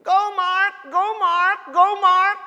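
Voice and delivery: loud, high cheering from the stands; shouting cheer. Retold or imitated. Mother shouting to her son at his baseball game, loud high cheer. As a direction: yell like a proud mom at a ball game. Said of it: "she is shouting".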